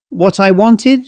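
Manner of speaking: high, sharp outcry of disbelief; indignant high cry; cried out. A narrator's reading. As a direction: throw the words back at him, high. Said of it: "she cried".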